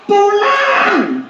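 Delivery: high, drawn-out call; demonstrated parent's yell. Shown as a demonstration. Parent yelling for a child to come home, high drawn-out shout. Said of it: "Misalnya Anda teriak".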